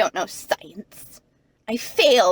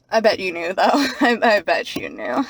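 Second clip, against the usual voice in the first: normal talking voice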